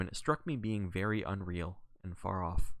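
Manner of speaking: ordinary delivery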